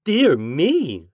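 high, loud surprised exclamation; exclaiming. Acted. Cured lady exclaims in surprise, high and loud. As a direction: burst out in surprise. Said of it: "she exclaimed".

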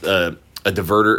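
ordinary talk

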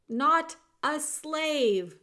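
slow, loud, emphatic words; shouted. A narrator's reading. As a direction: drive each word home at a shout. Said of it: "shouted the soldier".

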